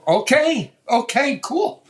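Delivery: amused, squeaky retold reply; high-pitched agreement. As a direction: go up high, as if meekly giving in. Acts own reply to Carl's boast.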